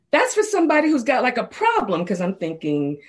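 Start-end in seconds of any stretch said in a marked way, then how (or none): 0.1-2.0 indignant, much higher and louder; retold outrage at the friend's suggestion; offended. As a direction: bristle as if insulted. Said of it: "then I got offended".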